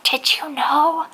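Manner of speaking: airy hushed imitation of a gossiping whisper; breathy secretive murmur, imitating; mock whisper. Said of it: "they whisper about it".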